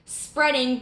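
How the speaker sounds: ordinary delivery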